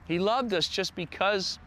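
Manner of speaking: ordinary talk, nothing marked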